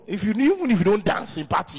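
ordinary talk

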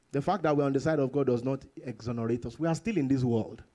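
usual speaking voice

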